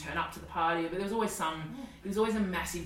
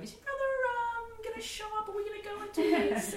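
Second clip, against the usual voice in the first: put-on high girlish whine; impatient teen girls. Teen girls waiting for her brother, high and whiny. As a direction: ask like a bored sixteen-year-old. Voicing sixteen-year-old girls.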